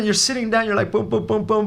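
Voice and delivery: normal talking voice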